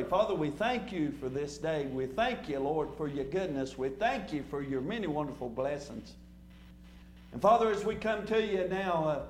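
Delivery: low, subdued prayer of thanks; opening prayer. Real, not acted. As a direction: pray reverently, keeping the voice low. Leads the congregation in prayer.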